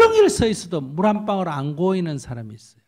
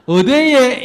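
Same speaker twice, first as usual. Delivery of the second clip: higher and louder Daegu "where?"; mock refusal; 7 semitones higher, 10 dB louder, much slower than usual. Preacher voices the woman's Daegu-style refusal, higher and louder. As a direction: toss it back as a dodge. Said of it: "그러니까".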